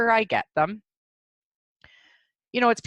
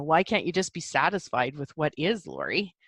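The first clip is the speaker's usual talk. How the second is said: annoyed other person; 6 semitones lower, 4 dB quieter than usual. Retold or imitated. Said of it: "it still can annoy other people".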